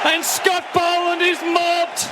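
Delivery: loud, high celebration call; excited call. Real, not acted. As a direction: shout it over the crowd. Wicket celebration, live call.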